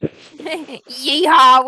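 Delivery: cowboy yeehaw. Acted. Cowboy character cheer.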